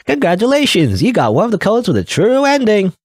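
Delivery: high, bright game-banner cheer; excited announcement. Acted. Reading the ending screen to viewers, excited announcer voice.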